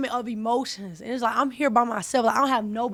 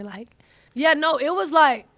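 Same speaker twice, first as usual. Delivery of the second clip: ordinary delivery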